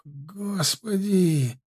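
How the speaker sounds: Господи drawn out very slow and moaning; a killer sighing in delight; moaned Господи. A narrator's reading. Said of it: "простонал он".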